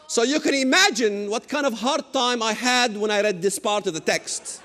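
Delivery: ordinary delivery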